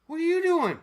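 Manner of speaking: high, loud alarmed calling out; retold coworker shout. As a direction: call out across the store, alarmed. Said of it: "he goes".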